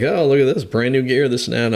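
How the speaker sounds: loud sing-out of fake admiration; mocking teasing. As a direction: imitate guys ribbing the new kid. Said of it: "guy started kinda messing with me".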